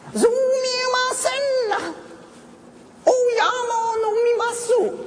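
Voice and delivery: high, slow Japanese villager voice, called out; an old village woman calling out as she climbs; old villager accent. Retold or imitated. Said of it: "the accent of a villager".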